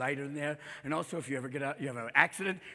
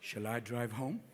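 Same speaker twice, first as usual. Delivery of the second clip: low, soft, slow butler-like car voice; polite talking car; 9 semitones lower, 11 dB quieter, slower than usual. Acted. A self-driving car speaking to its drunk owner, low and polite. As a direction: calmly offer the drunk a ride. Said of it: "the car goes".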